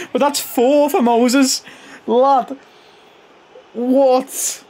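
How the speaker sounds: giddy hyped reaction; excited disbelief. Real, not acted. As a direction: shout it out amazed. Moses scores his fourth.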